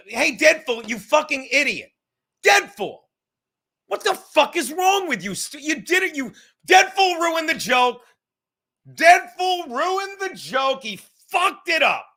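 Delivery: high, loud fake outrage; mock angry yelling. Real, not acted. Streamer yelling at Deadpool for ruining the joke, high and loud. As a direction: roast him loudly for ruining it.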